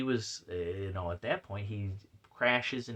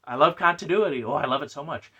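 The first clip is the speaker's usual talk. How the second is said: higher, louder gushing declaration; enthusiastic; 4 semitones higher, 8 dB louder, faster than usual. Real, not acted. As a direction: profess it with emphatic fondness. Professes love for continuity.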